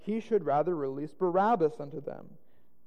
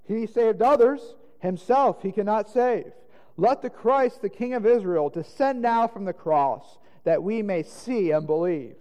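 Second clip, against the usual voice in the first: loud, raised, emphatic taunt; mocking; 4 semitones higher, 7 dB louder than usual. A narrator's reading. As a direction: jeer at him for all to hear.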